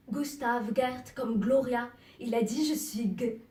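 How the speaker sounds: loud, insistent listing of names; agitated recall. Acted. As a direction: fling out each name forcefully. Searching aloud for forgotten name. (agité, à voix forte)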